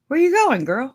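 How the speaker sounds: high, loud call after someone; playful high-pitched shout. Real, not acted. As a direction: holler it to a friend who's leaving. Calling after a departing viewer.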